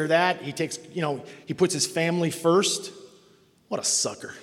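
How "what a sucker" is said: whispered sneer; scoffing aside. Retold or imitated. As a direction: scoff it under your breath.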